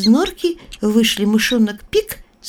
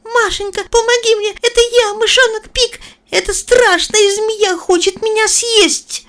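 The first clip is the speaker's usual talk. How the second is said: high, pleading little mouse; squeaky mouse voice; 4 dB louder than usual. Acted. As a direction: squeak for help like a frightened mouse. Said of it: "Мышонок пищал".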